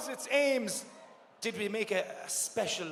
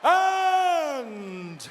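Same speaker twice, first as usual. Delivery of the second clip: dramatic announcement; 4 semitones higher, 5 dB louder, much slower than usual. Acted. Host builds up award announcement.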